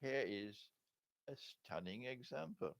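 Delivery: ordinary delivery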